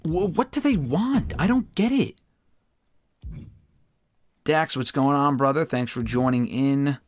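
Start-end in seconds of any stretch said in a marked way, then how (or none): 0.0-2.2 baffled. Real, not acted. Baffled by rejection.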